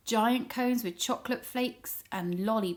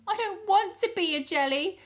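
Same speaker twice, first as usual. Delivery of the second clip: high, frightened cries for help; loud, high squealing for mummy and daddy; panicked wail; 10 semitones higher, 3 dB louder than usual. Acted. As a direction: wail like a scared child. Jelly pot panics, calls for help.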